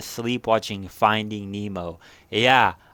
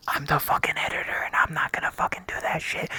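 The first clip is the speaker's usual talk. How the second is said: whispered aside; 3 semitones higher, 4 dB quieter, faster than usual. Real, not acted. Aside after asking editor.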